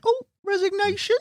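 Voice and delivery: high sing-song mockery of resignation; mocking a colleague who threatened to resign, high and singsong; mocking tease. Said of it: "shredded him saying".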